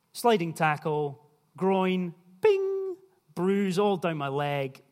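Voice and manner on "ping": high squeaky ping sound; mock ping. Preacher tells of his football groin injury, a high mock ping. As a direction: snap it out like something pinging.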